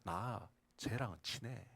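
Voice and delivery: quiet, slow, matter-of-fact; soft God voice. Retold or imitated. God quietly answers the jealous believer. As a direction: say it simply and softly, a gentle punchline. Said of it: "하나님 이렇게 이야기하십니다".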